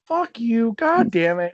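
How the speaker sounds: sudden high exasperated curse; stepdad's outburst. Retold or imitated. Stepdad cursing at the news. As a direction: blurt it out, half-mock annoyed. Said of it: "he was like".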